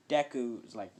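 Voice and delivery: ordinary delivery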